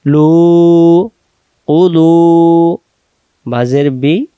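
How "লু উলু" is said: লু and উলু chanted with the final u held long; sustained loud final vowel on লু, উলু; elongated vowel. Shown as a demonstration. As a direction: stretch the u in লু and উলু, louder. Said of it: "ওয়াটাকে আমরা টানে নিয়ে যাব".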